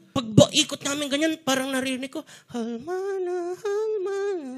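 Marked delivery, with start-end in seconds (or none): 2.5-4.5 slow, high, drawn-out Korean drama cry. Retold or imitated. Preacher mocking the slow-motion crash, slow and high like a Korean drama. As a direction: stretch it like a K-drama lament. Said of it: "Parang Korean drama".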